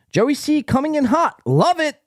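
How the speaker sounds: high, hyped-up readout; excited fan comment. Acted. Host reads a fan's comment on Joey, hyped-up. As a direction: read it like an overexcited fan.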